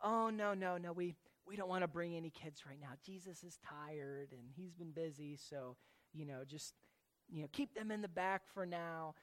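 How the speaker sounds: soft, low and casual excuse; mild brush-off. Acted. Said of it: "It's not just a".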